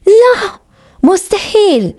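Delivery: high-pitched, angry refusal; angry cry. A narrator's reading. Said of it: "وصرخات بغضب".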